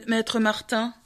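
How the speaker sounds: usual speaking voice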